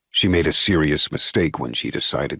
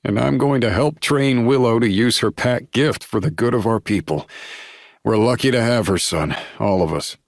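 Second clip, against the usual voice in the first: father voice; 7 semitones higher, 3 dB louder than usual. A narrator's reading. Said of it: "still catching his breath from his laugh attack".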